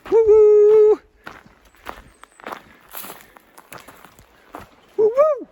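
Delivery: loud high woo calls; bear calls. Real, not acted. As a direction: call out to warn bears. Hiker calling out to bears.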